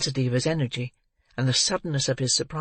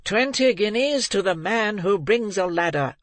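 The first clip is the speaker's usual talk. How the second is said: voice above all others; 6 semitones higher than usual. A narrator's reading. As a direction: bellow the reward over the mob. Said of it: "in a voice that rose above all others".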